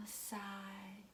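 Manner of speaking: ordinary delivery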